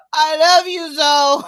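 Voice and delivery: loud, half-joking mock Sherelle after a gasp; imitating Sherelle. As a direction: announce it loudly as a playful Sherelle. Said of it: "I'll get better at Sherelle's voice".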